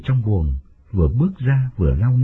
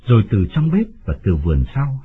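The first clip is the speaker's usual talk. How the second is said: plain reading voice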